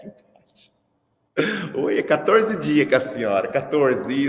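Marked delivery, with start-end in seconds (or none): 0.0-0.7 sussurrando de brincadeira, sem voz; mock whisper. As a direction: fala baixinho sem voz, como segredo. Mock quiet aside. (sussurro fingido para a mãe)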